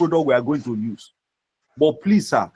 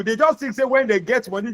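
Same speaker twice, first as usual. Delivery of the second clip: ordinary talk, nothing marked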